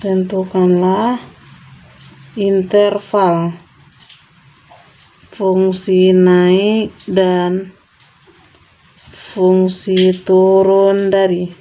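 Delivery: stretched syllables, long gaps between phrases; slow dictation. Real, not acted. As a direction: read very slowly as if writing along. Question repeated slowly, as if writing.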